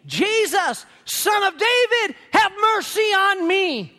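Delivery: high, urgent cry for mercy; acted screaming. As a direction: cry out like someone out of control.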